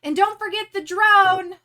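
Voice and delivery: high, loud call-out; Pixie's reminder. Acted. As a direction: sing it out as a cheery last-second shout. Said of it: "Pixie also says".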